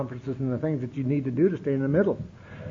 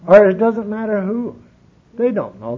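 ordinary talk

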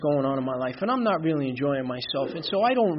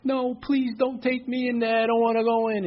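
retold crying plea; 9 semitones higher, 3 dB louder than usual. Patient begging brother outside the hospital, mock crying. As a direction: act out begging in tears, pitched well up. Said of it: "and I start crying".

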